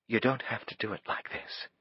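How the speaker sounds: quiet composed woman pleading gently; soft woman's voice. A narrator's reading. Faith voiced.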